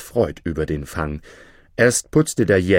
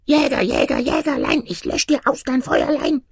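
very high, squeaky gnome voice; teasing chant in a tiny high voice; tiny man whispering. A narrator's reading. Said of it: "winzige Männchen und wisperte". (wisperndes Männchen mit hoher Piepsstimme)

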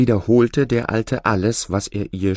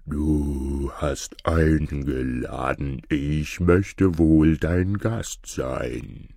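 low, very slow ox voice; a big calm ox speaking; 5 semitones lower, much slower than usual. A narrator's reading. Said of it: "und sprach".

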